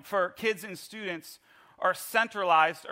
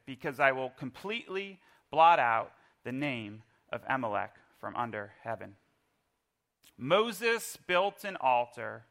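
scripture reading; 5 semitones lower than usual. Acted. Reads scripture aloud.

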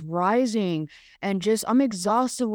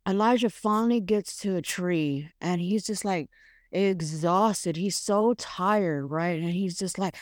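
ordinary talk

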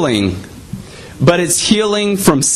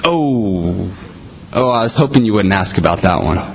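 lower, fast, laughing guilty confession; sheepish admission; 8 semitones lower than usual. Acted. Friend caught out by his accountability partner, sheepish laugh. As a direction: rush it out with an embarrassed laugh.

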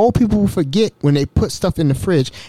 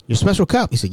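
ordinary talk, nothing marked

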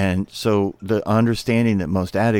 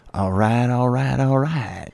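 a touch more Texan drawl on all right; lightly Texan drawled all right; Texas drawl; 4 semitones higher, slower than usual. Retold or imitated. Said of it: "You gotta get that Texas drawl in there".

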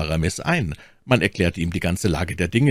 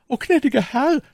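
very high, grateful maid's cry; freudiger Ausruf; 4 dB louder than usual. A narrator's reading. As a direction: call out thankfully in a high voice. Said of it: "rief Ketty". (dankbarer, hoher Ausruf)

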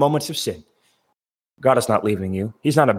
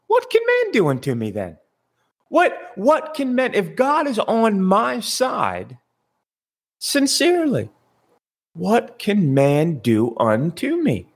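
incredulous question; 8 semitones higher than usual. Acted. Acts out psalmist's astonished question.

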